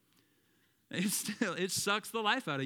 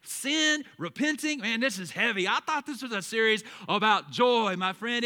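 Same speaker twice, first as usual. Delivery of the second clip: a hint of a doubting churchgoer, louder; skeptical listener; 3 semitones higher, 6 dB louder than usual. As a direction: voice the listener's protest, a bit higher and louder. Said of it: "some of you are thinking".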